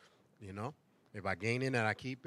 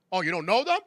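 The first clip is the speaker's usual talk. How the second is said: mock gotcha; 6 semitones higher, 10 dB louder than usual. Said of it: "I'd be like".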